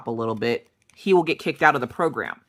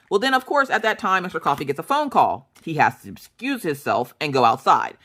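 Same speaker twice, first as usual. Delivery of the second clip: ordinary delivery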